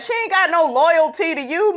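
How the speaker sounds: ordinary talk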